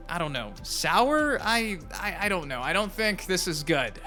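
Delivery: ordinary talk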